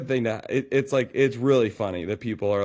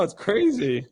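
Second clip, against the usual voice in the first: usual speaking voice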